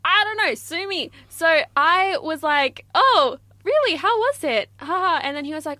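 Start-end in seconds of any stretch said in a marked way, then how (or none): 0.1-4.7 high, loud overeager people-pleaser; mock-keen cricket fan; eager fake enthusiasm. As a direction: gush to impress the boss. Retelling eager reply to manager.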